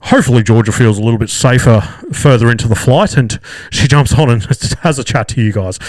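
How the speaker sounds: loud muffled PA voice dissolving into chuckles; mock intercom announcement ending in laughter; laughing pilot sign-off. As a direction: imitate a co-pilot laughing into the microphone. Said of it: "then he proceeds to laugh".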